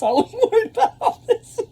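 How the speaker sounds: high, loud laughter through the words; laughing. Real, not acted. Laughs at own worry.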